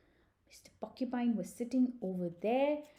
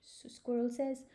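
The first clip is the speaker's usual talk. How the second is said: ordinary delivery, nothing marked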